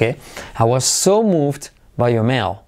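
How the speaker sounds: slow, clear model sentence; slow model phrase. Shown as a demonstration. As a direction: say it slowly for learners to copy. (lento y claro para repetir)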